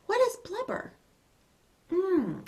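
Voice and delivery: usual speaking voice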